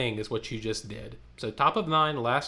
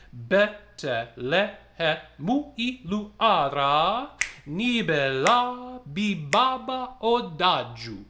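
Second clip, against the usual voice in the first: slow, higher, rhythmic chant of the text; rhythmic speaking; 5 semitones higher, slower than usual. Shown as a demonstration. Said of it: "One and go".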